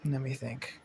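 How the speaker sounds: ordinary delivery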